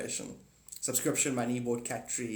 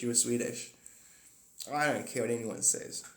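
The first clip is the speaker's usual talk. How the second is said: ordinary talk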